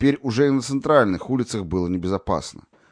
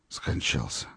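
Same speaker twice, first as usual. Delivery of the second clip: hushed whisper; 6 semitones lower, 7 dB quieter, slower than usual. A narrator's reading. Doctor quietly pronouncing the boy dead, hushed. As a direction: lower and much softer, barely voiced. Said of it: "прошептал доктор".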